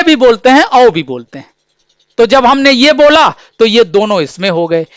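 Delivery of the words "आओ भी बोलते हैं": ordinary talk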